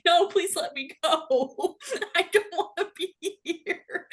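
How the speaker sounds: high, drawn-out plea through laughter; mock pleading. Fan pulled into a selfie, mock-desperate slow begging. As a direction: beg to be let go, half laughing.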